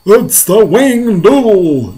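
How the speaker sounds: high, loud, drawn-out yell. Acted. Fire chief naming the monster, alarmed yell. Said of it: "yelled the head of the fire department".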